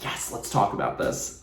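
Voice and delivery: hushed, gleeful invitation to argue; softly whisper, itching for the fight; eager whisper. Retold or imitated. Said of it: "so I was just like".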